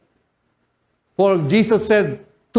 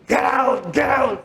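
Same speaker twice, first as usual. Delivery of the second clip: pastor's command; 5 semitones higher than usual. Retold or imitated. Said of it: "may isang pastor".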